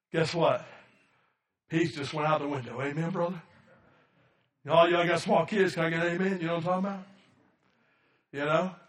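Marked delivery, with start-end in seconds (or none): none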